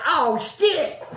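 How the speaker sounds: high, loud startled reaction; retold shock. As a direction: blurt it out in mock astonishment. Shocked reaction to man talking.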